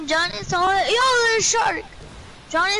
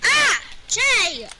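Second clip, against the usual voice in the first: yelling; much slower than usual. Real, not acted. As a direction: call out sharply to a friend. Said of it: "I'm gonna be yelling the top of my lungs".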